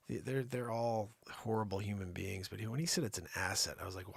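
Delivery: ordinary talk, nothing marked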